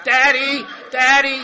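a hint of a needy child calling daddy; mock calling daddy. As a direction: call out daddy like a clingy kid. Mocking needy man calling out.

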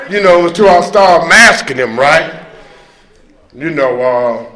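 ordinary talk

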